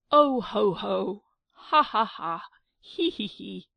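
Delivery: loud, breathless ho-ho, ha-ha, he-he cackle; choking laughter. Acted. Giglio laughing at Angelica's rage, loud choking laugh. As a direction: laugh till you nearly choke. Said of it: "he nearly choked himself with laughing".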